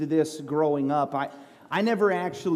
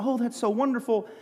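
high, sugary mock-delight; gushing waitress; 6 semitones higher than usual. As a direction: imitate a sweetly gushing waitress. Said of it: "And she said".

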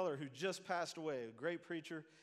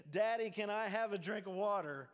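put-on small child asking; 4 semitones higher, 8 dB louder than usual. Child asking king at night.

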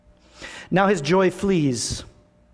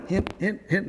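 joking hint; 3 semitones higher, 5 dB quieter than usual. Pretend. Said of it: "No, I'm kidding".

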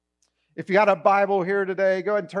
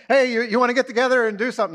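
quick, bright, higher eager ask; eager invite; 5 semitones higher, 5 dB louder, much faster than usual. Pretend. Friend eagerly asking someone to hang out, keen.

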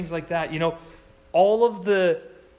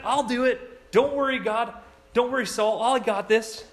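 lightly voiced eager kid, a bit higher; cocky and confident, pitched up; a bold young David; 6 semitones higher, 4 dB louder than usual.

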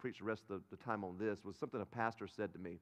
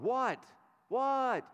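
exasperated dad; 11 semitones higher, 6 dB louder, much slower than usual. Retold or imitated. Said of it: "I'm like".

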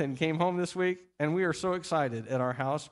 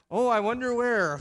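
playing along; 6 semitones higher, 5 dB louder than usual. Dad playing along with son.

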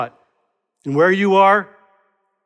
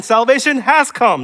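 "has" punched higher in the phrase; emphatic, raised delivery leaning hard on "has"; stress on "has"; 6 semitones higher, 4 dB louder than usual. Shown as a demonstration. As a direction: hit "has" firmly, insisting it is done. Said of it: "not will come".

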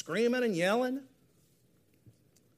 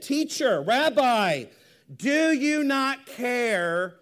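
loud, raised, drawn-out cry; frenzied plea; 5 semitones higher, 6 dB louder, much slower than usual. Acted. Frightened disciples waking their teacher, loud frantic plea. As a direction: call out urgently, stretching the last question. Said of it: "they're in a frenzy".

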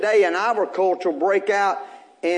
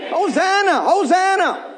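proclaiming; 7 semitones higher, 3 dB louder than usual. Acted. Echoing the crowd's cry.

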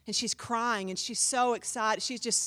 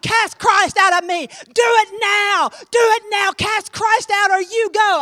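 full-force shouted command, high and loud; loud, high-pitched screamed challenge; screaming; 7 semitones higher, 13 dB louder, slower than usual. Retold or imitated. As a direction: scream the order at a demon. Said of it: "I mean, I'm screaming".

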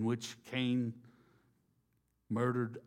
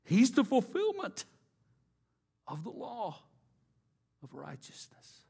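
exalted cry; 11 semitones higher, 3 dB louder than usual. Acted. Exalted climax then fade.

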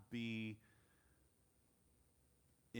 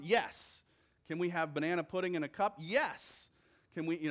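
higher and louder, gleeful yes to everything; eager tourist saying yes to every treat; excited quote; 6 semitones higher, 6 dB louder than usual. Retold or imitated. Brit quoted on inner dialogue.

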